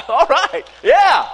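breathless giggling reply; laughing. Real, not acted. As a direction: laugh it out, breaking up. Laughing while speaking.

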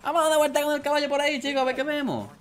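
cheerful high call to the horse; high excited call. Real, not acted. Calling horse, talking to viewers. (llamada alegre y aguda)

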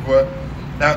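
normal talking voice

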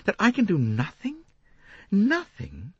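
high, disbelieving rising question; incredulous. Acted. Lynette disbelieving Poirot's advice, high and incredulous. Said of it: "said Lynette incredulously".

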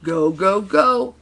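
loud chant climbing high; storyteller's booming cheer; thundering crowd. A narrator's reading. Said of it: "thundered the crowd".

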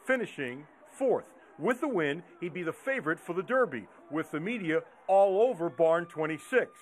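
measured, slightly low TV voiceover; news feature narration. A narrator's reading. Reporter recaps the horse's defeat for viewers, measured voiceover. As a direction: narrate the setback like a sports feature.

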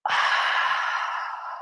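long, soft, airy exhale; deep breath out. Shown as a demonstration. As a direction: let a deep breath slowly go.

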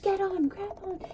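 urgent insisting. Retold or imitated. Mom insisting again.

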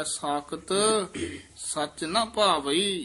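ਸਾਕਤ set apart by a clear halt; pause after ਸਾਕਤ. Shown as a demonstration. Teacher showing where to halt, pause after ਸਾਕਤ. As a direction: hold a pause after ਸਾਕਤ, then slowly on. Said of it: "ਸਾਕਤ ਪਦ ਤੇ ਵੀ ਯਮਕ ਹੈ".

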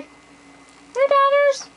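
high pet voice. Real, not acted. Owner calling her dog Donners over, in a high excited squeal.

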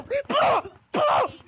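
high-pitched pew-pew gun noises; squeaky mouth-made shots; pretend gunfire. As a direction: fire a toy gun with your voice. Imitates gunfire while attacking.

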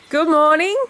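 bright high good morning, called out; loud chirpy morning call; cheery loud greeting. Real, not acted. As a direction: sing out a cheerful hello. Greeting mum loudly on camera.

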